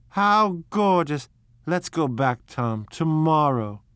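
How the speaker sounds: gushing delight. Acted. Daisy gushing over the wreath.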